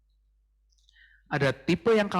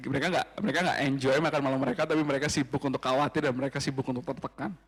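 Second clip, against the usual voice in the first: ordinary talk, nothing marked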